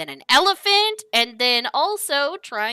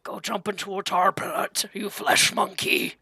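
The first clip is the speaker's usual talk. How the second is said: a touch lower, half-voiced robot retort; altered robot voice; 3 semitones lower, 4 dB quieter than usual. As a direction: answer through a tweaked voice box. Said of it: "adjusts something on his throat".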